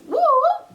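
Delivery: very high, loud wailing siren noise; siren imitation. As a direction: mimic a police siren.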